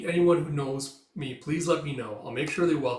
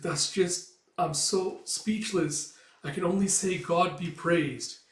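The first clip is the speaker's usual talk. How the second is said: higher, slow and breathless with awe; overwhelmed gratitude; 6 semitones higher, 4 dB louder, slower than usual. Retold or imitated. Grateful parent thanking their host, slow and high. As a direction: stretch it out, moved to near tears of thanks.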